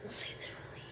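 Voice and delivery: breathy whispered aside; a single whispered word. Real, not acted. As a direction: drop to a whisper for effect. Whispered emphasis.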